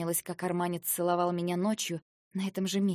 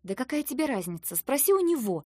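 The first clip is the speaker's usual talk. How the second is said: higher pitch, rising to louder; annoyed, pitched-up comeback; irritated retort; 6 semitones higher, 4 dB louder than usual. A narrator's reading. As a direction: brush the question off crossly. Irritated heroine snaps back at questioner.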